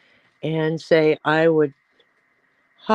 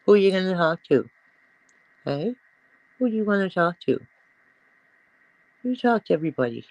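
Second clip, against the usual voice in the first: fast pet talk; 2 semitones higher, much faster than usual. Real, not acted. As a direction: tease the dog fast and playful. Baby talk to the chatty dog.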